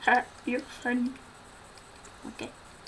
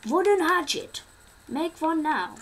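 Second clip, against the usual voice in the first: higher, louder item call-out; excited announcing; 7 semitones higher, 8 dB louder, slower than usual. Acted. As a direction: call it out like a win. Crafting, announcing items.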